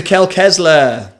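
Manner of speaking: real burst of recognition, stretched out; triumphant recall. Real, not acted. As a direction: call out the name you finally remembered. Suddenly gets the forgotten name.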